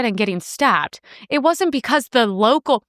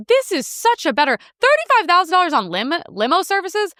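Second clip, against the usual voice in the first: higher-pitched, disbelieving outburst; mock incredulity; 6 semitones higher than usual. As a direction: balk at the figure in exaggerated disbelief. Mock reaction to limo spending.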